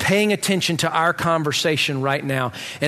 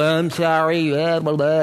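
drawn-out, bored "I'm sorry" with a sarcastic edge; mock dismissive apology; 2 semitones lower, slower than usual. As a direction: roll your eyes through a stock "I'm sorry". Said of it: "give her the old".

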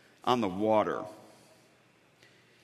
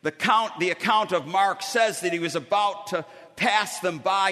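usual speaking voice

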